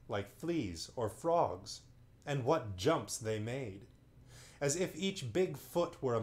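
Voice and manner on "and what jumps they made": usual speaking voice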